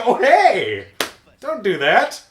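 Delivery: loud, high indignant telling-off; comic outraged yell; mock scolding. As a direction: burst out in hammed-up protest. Retold reaction to the grab.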